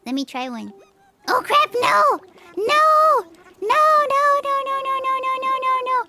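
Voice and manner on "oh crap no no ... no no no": high, loud yells into rapid no-no-no; panicked shouting. Real, not acted. Streamer pressing the wrong button at countdown, high panic. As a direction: yelp in panic, then stammer it out high.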